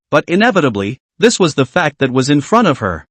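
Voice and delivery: plain reading voice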